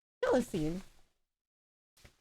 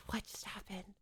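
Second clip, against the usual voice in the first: whispered, much softer, in disbelief; hushed shocked whisper; stunned whisper; 16 dB quieter than usual. Real, not acted. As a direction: breathe it out, dazed. Repeats in confusion.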